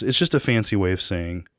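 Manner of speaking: usual speaking voice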